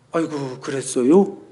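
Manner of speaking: slow, drawn-out mock sympathy on "아이고, 그랬어요?"; sarcastic. Wife answers her grumbling husband with slow sarcasm. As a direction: sneer it as fake concern. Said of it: "약간 비아냥거리는 투로".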